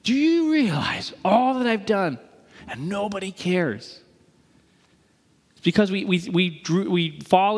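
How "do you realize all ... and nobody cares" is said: slow, strained martyr's outburst; drawn-out, aggrieved mock explosion; exploding in resentment. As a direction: act out a burned-out volunteer blowing up. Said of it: "You explode".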